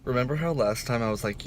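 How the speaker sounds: ordinary talk, nothing marked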